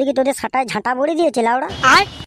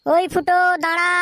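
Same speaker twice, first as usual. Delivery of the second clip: high-pitched call after someone; 6 semitones higher than usual. Acted. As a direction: holler for someone to stop. Shouting after someone to stop.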